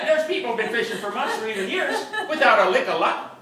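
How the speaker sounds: ordinary delivery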